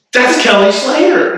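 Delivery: high-pitched disbelieving cry; incredulous exclamation. Retold or imitated. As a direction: blurt it out high, as astonished friends. Friends astonished at his ignorance.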